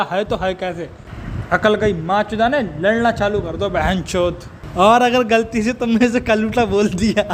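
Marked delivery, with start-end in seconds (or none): 4.8-7.2 laughing. Real, not acted. Laughing at own joke.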